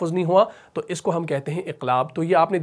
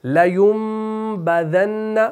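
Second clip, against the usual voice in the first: higher, very slow chanted word; Quran recitation; 4 semitones higher, 4 dB louder, much slower than usual. Shown as a demonstration. Teacher repeats the key word for learners, slow chant. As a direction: hold the nasal m long as you chant. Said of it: "ये भी आप पढ़ते हैं".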